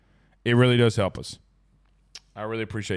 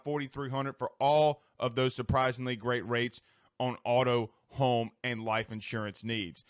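softer, intimate insurance spot; soft ad read; 7 dB quieter than usual. Acted. Host reading the State Farm spot softly. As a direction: read it close and smooth like a radio ad.

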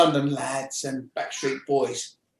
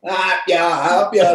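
laughing; 4 semitones higher, 7 dB louder, slower than usual. Retold or imitated. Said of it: "I'll be laughing, and be like".

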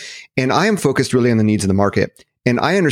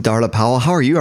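normal talking voice

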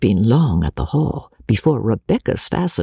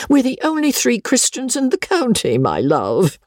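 put-on high, delighted old lady's voice; 11 semitones higher than usual. Old lady praising Becky, high and delighted. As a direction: coo over her new favourite.

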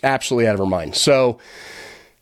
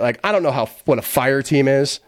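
ordinary talk, nothing marked

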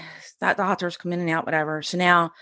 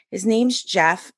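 usual speaking voice

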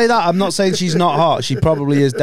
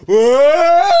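high, loud cartoonish whoa; cartoon windup yell; 9 semitones higher, 5 dB louder than usual. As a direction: yell it like a cartoon character winding up a punch. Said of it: "like a cartoon".

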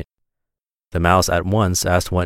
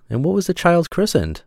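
high-pitched curious mouse; high, inquisitive question; inquiring mouse; 10 semitones higher than usual. A narrator's reading. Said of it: "the mouse inquired".

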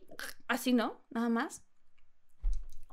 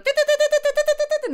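high, loud mock beeps; altimeter beeping; 10 dB louder, faster than usual. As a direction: make the alarm beep fast. Mimics altimeter beeping again.

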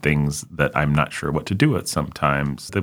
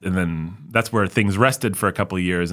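ordinary talk

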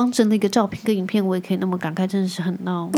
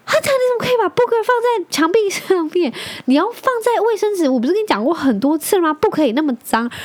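high, laughing mock scolding, higher; 10 semitones higher than usual. Real, not acted. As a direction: scold him while unable to stop laughing. Mom scolds son while laughing.

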